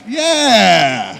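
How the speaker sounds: high, loud drawn-out cheer; big celebratory shout. Real, not acted. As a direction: whoop for the winner. Host hails the raffle winner.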